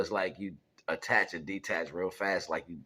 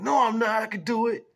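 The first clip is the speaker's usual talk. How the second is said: put-on high, loud childish insistence; protesting kid; 10 dB louder than usual. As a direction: argue back loudly like a stubborn kid.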